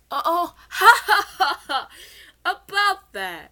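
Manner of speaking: loud, high nervous laughter. Acted. Pharaoh caught out by Seto laughs nervously, high and loud.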